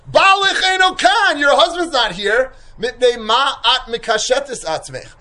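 high, loud, jeering neighbor; needling gossip voice; neighbor's loud taunt. Retold or imitated. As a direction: call out to her like a nosy neighbor. Neighbor taunts the sailor's wife.

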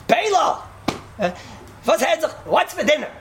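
high-pitched call to a wife. Acted. Husband testing his wife's hearing from the door, calling out high. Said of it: "stand by the door and you say".

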